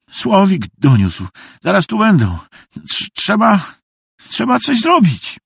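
high, stammering urgency; frantic explaining. Acted. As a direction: plead urgently, pitch climbing, tripping over words. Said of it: "tłumaczył bezładnie". (bezładne, rozpaczliwe ponaglanie)

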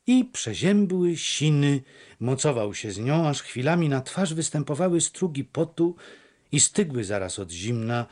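ordinary delivery

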